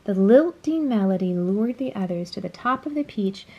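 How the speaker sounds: usual speaking voice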